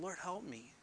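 very soft, under-the-breath appeal; murmured prayer. Retold or imitated. Preacher recalls praying this all the time, very softly. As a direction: drop the voice right down as if praying privately. Quoting own frequent private prayer.

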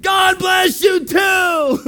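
very high, long-stretched holler; shouted reply blessing. Retold or imitated. Pastor answering locals who hail him, joyful drawn-out shout. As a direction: shout it back slowly and joyfully.

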